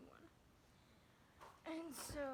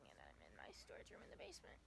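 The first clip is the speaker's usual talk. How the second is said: whispering; 9 dB quieter, faster than usual. Real, not acted. In cold basement, whispering.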